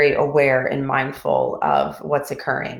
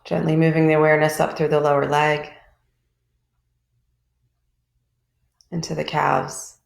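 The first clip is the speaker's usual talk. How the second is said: calm, even voice with long pauses between phrases; guided meditation. Real, not acted. Teacher leading a group body scan from toes upward, calm guided meditation.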